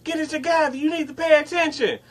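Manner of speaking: high, fast nagging coworker voice; mock bossy coworker; scolding coworker. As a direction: nag him in her high voice. Coworker scolding him in story.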